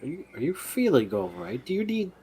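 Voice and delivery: high, loud teasing offer; mock sweetness. Snips taunting Riolus about his wound, high and mock sweet.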